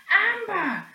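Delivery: high, loud call; boy shouting to Amber to catch him. Acted. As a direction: yell across to her. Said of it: "he shouted".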